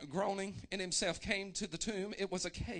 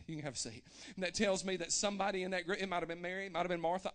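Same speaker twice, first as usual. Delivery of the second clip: usual speaking voice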